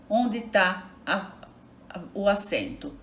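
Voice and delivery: ordinary talk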